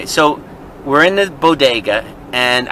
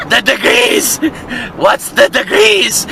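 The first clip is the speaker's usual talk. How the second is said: put-on higher, drawn-out Turkish-accented insistence; imitated Turkish accent; 6 semitones higher, slower than usual. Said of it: "he had a little accent".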